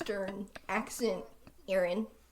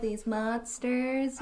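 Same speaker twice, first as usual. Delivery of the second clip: normal talking voice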